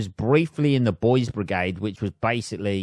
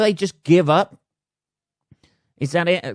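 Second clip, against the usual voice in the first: indignant disbelief; 8 semitones higher, 5 dB louder than usual. Real, not acted. Outraged at the search.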